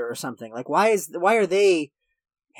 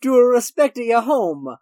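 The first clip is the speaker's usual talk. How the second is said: louder, higher, slow mock Japanese accent; 6 semitones higher, 7 dB louder, much slower than usual. Finishing the movie cop's line, slow mock Japanese accent. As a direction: drag it out in a hammed-up Japanese accent. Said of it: "I'm gonna do a fucking racist accent".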